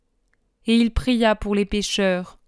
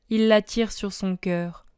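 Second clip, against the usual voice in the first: ordinary delivery, nothing marked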